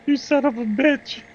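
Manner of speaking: mock indignation. Repeats insult, now pitched up.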